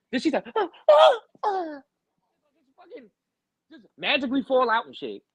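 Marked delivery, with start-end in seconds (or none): 0.5-1.8 high, loud gasping moans, tailing off; mock fainting gasps. Man imitates her collapsing, mock fainting gasps. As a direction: imitate someone wailing as they pass out.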